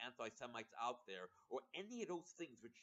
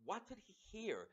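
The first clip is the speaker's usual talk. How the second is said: ordinary talk, nothing marked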